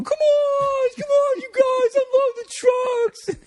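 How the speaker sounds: very high, loud, slow mock whine; mocking squeaky impression. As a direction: exaggerate his squeak to make fun of him. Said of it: "we would just do this impression of him back".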